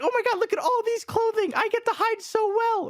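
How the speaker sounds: put-on high childish glee; baby voice. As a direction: sound like an excited toddler. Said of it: "That's, that's my T B H baby voice".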